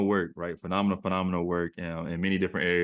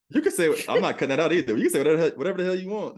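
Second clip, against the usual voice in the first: high, loud and fast, laughing it off; eager go-ahead to curse freely; host hyping up the guest, excited; excited insistence; 9 semitones higher, 5 dB louder, much faster than usual. Real, not acted.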